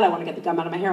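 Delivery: ordinary talk, nothing marked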